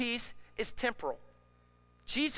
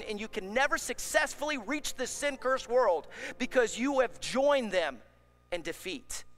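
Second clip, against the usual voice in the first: usual speaking voice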